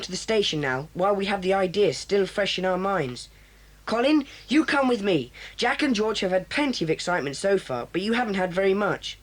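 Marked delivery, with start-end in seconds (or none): none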